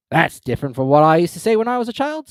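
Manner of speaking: puzzled and dismissive; a loud Griffin comment. A narrator's reading. Griffin voiced in story.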